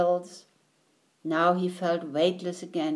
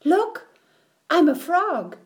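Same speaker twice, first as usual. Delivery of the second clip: high, loud triumphant cry; 9 semitones higher, 5 dB louder than usual. A narrator's reading. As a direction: crow it out proudly like a new frog. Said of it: "he said triumphantly".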